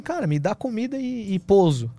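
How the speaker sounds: high, pleading scrounger; begging voice. Retold or imitated. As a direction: whine for food and a bed. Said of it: "Ele falou assim, ó, um sinalzinho de comida".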